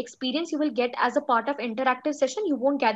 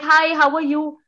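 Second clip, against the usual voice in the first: bright hello, louder and lifted; chirpy greeting; 3 semitones higher, 6 dB louder than usual. Shown as a demonstration. Teacher showing students a tone that hides sadness, chirpy. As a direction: greet cheerily, as if nothing were sad. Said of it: "You'll think I'm a very chirpy person".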